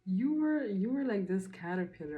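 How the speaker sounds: high, loud, excited burst of praise; blurting it out, thrilled for her; excited outburst. Real, not acted. Vlogger hyping up a viewer about her growth, excited and loud.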